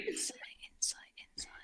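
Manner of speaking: fast whispered repetition; a player whispers the skill name over and over; whispered muttering. Real, not acted. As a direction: whisper it to yourself quickly. Whispering to self, unvoiced.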